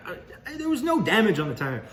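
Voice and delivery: high, loud, stammering denial; indignant protest. Acted. Employee defending himself to the boss over the tire, indignant. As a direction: protest your innocence, pitch jumping up.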